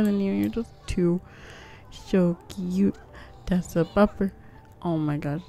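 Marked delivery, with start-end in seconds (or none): none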